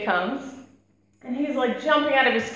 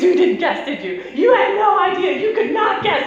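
much higher, gloating and loud; triumphant kid brother; 7 semitones higher, 5 dB louder than usual. Retold or imitated. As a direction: crow over the successful prank. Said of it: "he goes".

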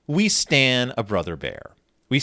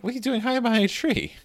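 puzzled; 10 semitones higher, faster than usual. Retold or imitated. Voicing puzzled Brother.

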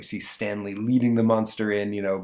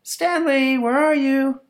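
much higher, louder call across the house; mom calling out; 6 dB louder, slower than usual. Acted. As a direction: call for the boy like a mother searching rooms. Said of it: "calling out".

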